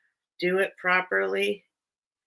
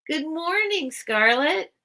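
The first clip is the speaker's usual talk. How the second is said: cheery greeting; 9 semitones higher, slower than usual. Real, not acted. Greeting a viewer.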